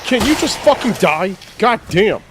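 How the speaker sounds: high furious shout; angry yell. Real, not acted. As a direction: lash out in real frustration. Yelling at someone off mic.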